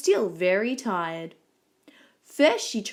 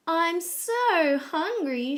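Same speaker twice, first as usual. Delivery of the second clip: higher, drawn-out whine of hunger; hungry Goldilocks; 8 semitones higher, much slower than usual. A narrator's reading. Goldilocks spotting the toast, hungry and longing. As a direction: stretch it slowly, pitched up. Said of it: "she said".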